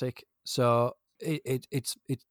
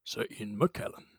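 lightly attempted grand actor voice, lower; half-baked impression, low and measured; Ian McKellen; 4 semitones lower, 8 dB quieter than usual. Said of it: "That was an awful impression".

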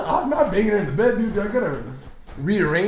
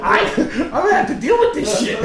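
high, laughing complaint; high-pitched laughing groan of a line; 9 semitones higher, 5 dB louder than usual. Real, not acted. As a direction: say it through laughter. Laughing aside.

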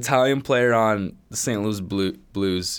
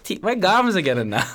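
a hint of an old Italian grandma, higher and louder; mock nonna voice, pitched up; 9 semitones higher, 5 dB louder than usual. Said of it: "I can't even do it, Grandma".

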